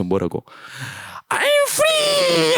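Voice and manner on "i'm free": very high, drawn-out creature cry; triumphant shout. Acted. Creature freed of its evil side, shouting out. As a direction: shriek out the release in a creature voice. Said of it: "막 외쳐".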